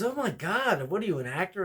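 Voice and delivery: high, loud, amazed woman; starstruck lady. Retold or imitated. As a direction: gasp like she spotted a star. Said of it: "And she goes".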